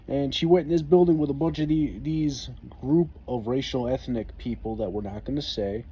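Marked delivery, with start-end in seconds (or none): none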